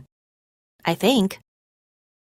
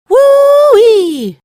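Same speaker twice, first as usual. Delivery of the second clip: yell; 11 dB louder than usual. A narrator's reading. Guys on bikes yelling in surf.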